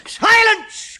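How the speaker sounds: loud, high command. A narrator's reading. Chemist commanding silence, loud and high. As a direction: silence them all at once. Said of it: "commanded the chemist".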